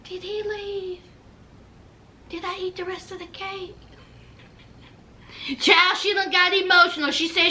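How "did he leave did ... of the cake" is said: dazed stoned mumble. Retold or imitated. Puppy answering her friends in a dazed, soft mumble. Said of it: "Honey, she was like".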